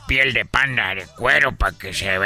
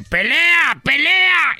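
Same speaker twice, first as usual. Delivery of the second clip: loud, very high mock yell; retold alarm shout; 5 dB louder, much slower than usual. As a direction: shout as if a fight broke out. Retold call that draws a crowd.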